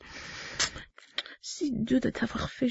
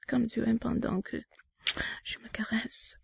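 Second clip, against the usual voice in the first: soft intimate whisper; 9 dB quieter than usual. Acted.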